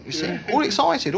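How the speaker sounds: ordinary talk, nothing marked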